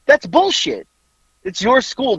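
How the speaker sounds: indignant protest. Retold or imitated. Acting out his side of argument.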